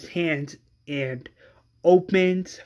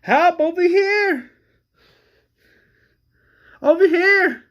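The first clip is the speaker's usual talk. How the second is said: much higher and louder, calling for help; screaming for help; 6 dB louder than usual. Acted. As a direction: scream it across the farm. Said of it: "he is screaming".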